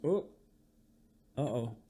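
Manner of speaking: ordinary delivery, nothing marked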